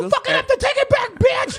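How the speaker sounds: high shrieking yell; angry yell. Acted. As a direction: screech it in a rage. Irate woman voiced.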